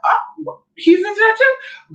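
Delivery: high, gasping disbelief; mock shocked. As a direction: fake being shocked.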